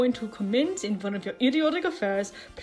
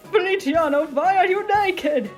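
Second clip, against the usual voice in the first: flustered demand; 9 semitones higher, 8 dB louder than usual. Acted. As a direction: demand an explanation in alarm.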